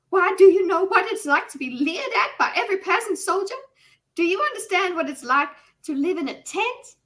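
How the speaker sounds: put-on runaway noblewoman pleading with the king in a Southern accent; hyped-up Southern belle. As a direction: gush in an over-the-top Southern accent. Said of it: "could you do Southern again, but, like, hyped up".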